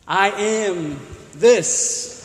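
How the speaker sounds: stretched out slowly, every word set apart; drawn out so the sentence sounds unfinished; slow demo. Shown as a demonstration. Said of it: "It's not a good sentence".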